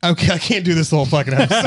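fast, laughing through the words; laughing while dropping the accent; breaking into laughter. Real, not acted. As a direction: crack up and give up the bit. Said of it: "trying so hard to keep a straight face".

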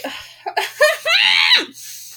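loud, high laugh-scream; a real exasperated laugh-shout; frustrated laughing yell. Real, not acted. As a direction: let out a fed-up laughing shout. Frustrated outburst.

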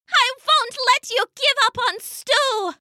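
high little mouse protesting; tiny shout. A narrator's reading. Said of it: "came a tiny shout".

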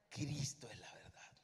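Cristo, whispered in awe; reverent whisper. Acted. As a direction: breathe the answer like a secret. Answering who is the truth.

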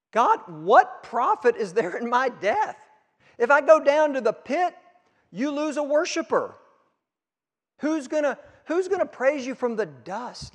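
high, insistent pleading argument; preacher acting out a heated appeal; arguing with God. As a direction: plead your case like a protesting teenager. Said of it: "He argues with God like a good lawyer, like your teenagers".

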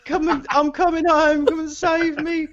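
put-on high, whiny, slow drunk plea; drunk pleading. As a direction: wail like a lost drunk begging rescue. Drunk friend pleading on phone.

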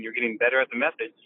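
usual speaking voice

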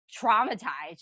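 normal talking voice

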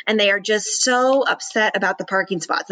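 normal talking voice

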